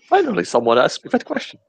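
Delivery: high, fast gleeful cry; excited shout. Pretend. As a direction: burst out in delight. Character exclaims in delight.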